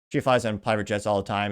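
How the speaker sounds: ordinary talk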